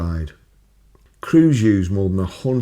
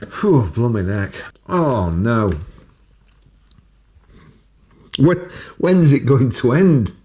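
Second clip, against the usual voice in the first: mock despair; 3 semitones higher, 4 dB louder than usual. Real, not acted. Mock despair at more fires.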